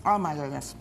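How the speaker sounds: talking through a bite; words round a mouthful; mouth full. Real, not acted. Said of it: "not nice to talk with your mouth full".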